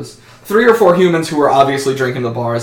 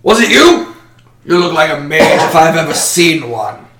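higher, louder accusing question; suspicious interrogator; 4 semitones higher, 6 dB louder than usual. Acted. Official questioning the gnome, higher and accusing. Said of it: "and he says".

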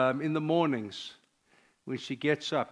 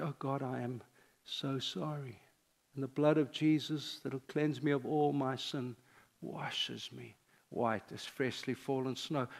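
hushed prayer; 2 semitones lower, 8 dB quieter than usual. Shown as a demonstration. Pastor showing the congregation how to confess, hushed. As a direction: let the words fall quietly, reverent.

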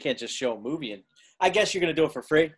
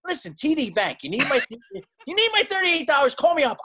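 frustrated rant; 8 semitones higher than usual. Real, not acted. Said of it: "Sorry, very frustrated".

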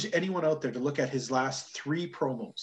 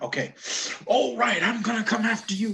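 a hint of Ric Flair coming after someone; mild mock Ric Flair threat; Ric Flair energy; 8 semitones higher than usual. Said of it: "had this energy and went".

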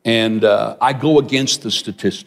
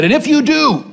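very high, emphatic imitated warning; warning preacher; 4 dB louder than usual. Preacher warning the young man against his plans, emphatic. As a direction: pitch it way up like a preacher's ultimatum.

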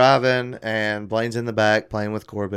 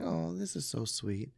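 quiet mock sweetness; 6 semitones higher, 13 dB quieter than usual. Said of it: "Trisha's like".

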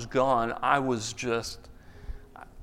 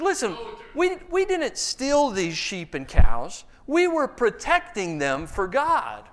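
higher, slow excuse-making; sheepish Saul; 7 semitones higher, slower than usual. Acted. Saul to Samuel, a high wheedling excuse. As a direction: play Saul as a guilty kid with an alibi. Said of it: "He says".

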